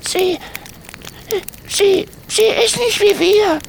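put-on very high child's voice, halting repeats; a guilty kid stumbling over words; stammering boy. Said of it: "stammelte Tommy".

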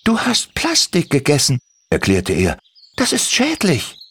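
leicht höher gesprochener Kobold, freundlich; junger Kobold. Acted. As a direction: ein kleiner, eifriger Kobold fragt nach. Timmy erklärt. (Kobold Timmy fragt Andi, leicht höhere Stimme)

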